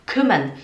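short Ü in "kümmern"; quick first vowel in "kümmern"; correct short vowel. Shown as a demonstration. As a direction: model "kümmern" low and brief. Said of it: "Não é kümmern".

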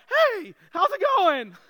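put-on high, loud squeaky teenage boy; awkward teenage boy cracking high as he runs in. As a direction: play the pimply kid squeaking a greeting. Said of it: "squeaky voice and all and acne".

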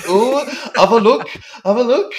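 high, loud hammed-up voice for the press; Spike impression. Spike showing off to the press, hammed-up high and loud. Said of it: "he goes outside and he's like".

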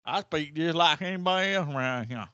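hammed-up Southern twang, protesting; exaggerated Southern accent. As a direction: play a Southerner denying any accent. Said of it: "it's like a Southern song".

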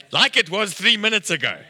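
mock-exasperated snap back; irritated retort. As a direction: act out losing patience with a waiter. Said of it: "could see me getting irritated".

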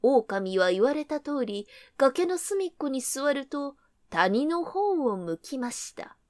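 ordinary delivery, nothing marked

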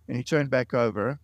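ordinary delivery

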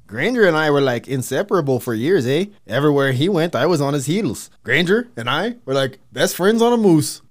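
loud, hammed-up Canadian friend; loud mock Canadian reminiscence; mock Canadian accent. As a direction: play the folksy Canadian buddy. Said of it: "his best friend Robert recants".

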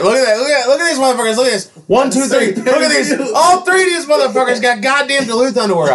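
high, loud, shouting brag; excited show-off. Real, not acted. Showing his friends his Duluth underwear, shouting. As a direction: hype up the underwear like a carnival barker.

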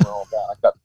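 ordinary delivery

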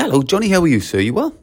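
high, lilting courteous hello; formal friendly greeting. Shown as a demonstration. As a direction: greet a pupil brightly and properly, voice lifted. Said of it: "it's a little bit more formal".

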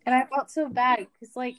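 normal talking voice

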